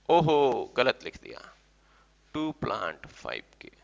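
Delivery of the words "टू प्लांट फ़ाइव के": very soft, low, near-whispered; under the breath, writing it down; murmuring while writing. Real, not acted. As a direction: dictate it quietly to yourself.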